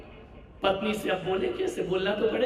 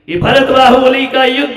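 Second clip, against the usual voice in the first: loud, high declaiming preacher; booming proclamation; 6 semitones higher, 15 dB louder than usual. Real, not acted. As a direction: thunder it out to the crowd. Preacher booms at crowd.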